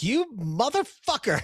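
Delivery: high, slow snarky jab. Shown as a demonstration. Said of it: "really snarkily say".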